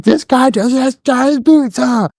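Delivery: high, slow, mocking drill instructor; hammed-up drill sergeant taunt. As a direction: needle the recruit like a sneering DI.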